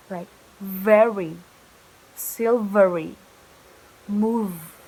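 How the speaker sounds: very, silvery, move with a buzzing V; bold V. Shown as a demonstration. Teacher showing a bold V for students. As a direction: press the V hard in very, silvery, move. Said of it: "pronounce it bolder".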